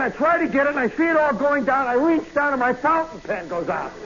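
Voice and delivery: plain reading voice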